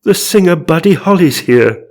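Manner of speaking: high, loud theatrical announcement; overexcited character reveal; mock astonishment. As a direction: proclaim the ghost's name in hammed-up awe. Gasped announcement of celebrity ghost.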